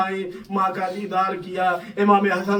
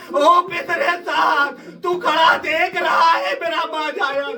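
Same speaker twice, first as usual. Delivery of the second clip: sister's lament; 6 semitones higher than usual. Retold or imitated. A sister calls to her brother at Karbala, lamenting cry. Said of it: "ایک بہن اپنے بھائی کے لیے کہہ رہی".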